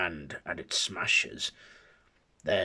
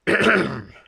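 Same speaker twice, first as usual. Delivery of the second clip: loud throat clear; 8 semitones higher, 14 dB louder than usual. Acted. Griffin announces invisible presence.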